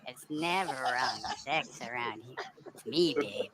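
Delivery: low, sultry come-on; low seductive. Pretend. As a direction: purr it like a flirt.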